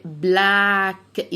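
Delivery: بلاك drawn out very slowly, one k at the end; stretched demo. Shown as a demonstration. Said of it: "انطقهم كأنهم كه واحدة". (بلاك ببطء شديد)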